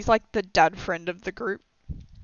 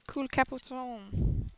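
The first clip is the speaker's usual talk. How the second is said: slightly French-sounding accent; 3 semitones higher, 12 dB quieter, slower than usual. Real, not acted. Unsure how to say the word, falls into a French accent. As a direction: lapse into a soft French accent. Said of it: "That sounded French".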